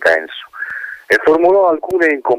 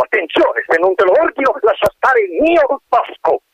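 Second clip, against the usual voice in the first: shouting at full voice. Acted. Colonel runs to the hole shouting to the wind. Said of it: "gridando a tutta voce".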